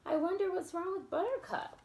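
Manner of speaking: plain reading voice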